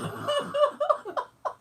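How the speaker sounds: very high giggling burst; real high-pitched laughing fit. Real, not acted. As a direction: crack up at your own punchline. Cracks up at own story.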